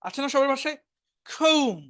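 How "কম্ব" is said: higher-pitched কম্ব as "kohm", no b; silent b in comb. Shown as a demonstration. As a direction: model কম্ব with a long o and final m only. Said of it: "এই বিটা উচ্চারণ হবে না". (উঁচু সুরে "কৌম", কম্ব-এর ব অনুচ্চারিত)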